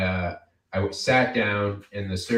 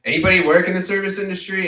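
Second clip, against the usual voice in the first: higher, louder fast crowd question; calling out; 9 semitones higher, 8 dB louder, faster than usual. Real, not acted. Comic polling the audience, calling out. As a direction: call out to the room. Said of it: "crowd work".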